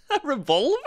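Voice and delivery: stunned by the absurd idea; incredulous. Real, not acted.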